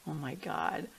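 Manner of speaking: whispered, fading very soft; hushed awe. Retold or imitated. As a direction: whisper it in quiet wonder, looking back. Looks back in hushed awe.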